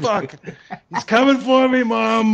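put-on high, loud scared kid calling Mom; hammed-up frightened child; panicked kid. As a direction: cry out to Mom in mock panic.

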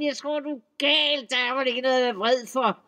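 high, loud troll voice, the end fast; furious troll insisting he isn't angry. A narrator's reading. Troll denies anger, shouting.

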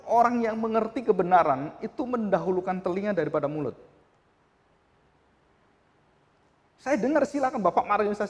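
high, loud pulpit cry building up; preacher thundering at the flock; fiery preaching. Acted. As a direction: rouse the congregation at full voice. Preaching at full force.

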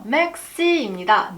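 맥시입니다 said slowly, 맥 closed like a final k; careful slow demo of 맥시입니다; 맥시입니다 spoken slowly for learners; slow model pronunciation. Shown as a demonstration. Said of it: "한번 먼저 따라해볼까요".

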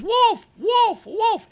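put-on high, loud, shrill-pitched boy's call; boy crying wolf. Narrator voicing the boy crying wolf, high calling out. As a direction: call out like a bored shepherd boy faking alarm.

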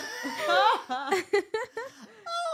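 loud, high, long laugh; real helpless peal of laughter; uproarious laughter. Real, not acted. Friends laughing about a first date at Pizza Hut, uproarious laughter.

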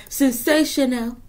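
slow, lifted "Sensational" to a client; drawn-out admiring "Sensational". Shown as a demonstration.

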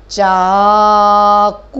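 चाकू slow and loud, ऊ held; long ऊ. Shown as a demonstration. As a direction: model चाकू slowly. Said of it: "किस पे मात्रा आई?".

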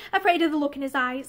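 ordinary delivery, nothing marked

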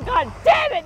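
high, loud cursing; pained curse. Acted. Woman cursing a long contraction, pained angry yell.

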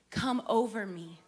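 ordinary delivery

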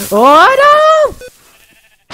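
sudden high, loud startled oh; startled cry. Real, not acted. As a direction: yelp in shock at the block. Bad lucky block outcome.

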